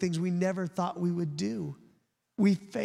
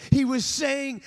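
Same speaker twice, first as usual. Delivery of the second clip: usual speaking voice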